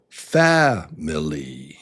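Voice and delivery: slow family, FAM strongest; family as a drawn-out FAM-i-ly; stress at the start. Shown as a demonstration. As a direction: start family on its strong beat, then fall. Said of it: "or you start there".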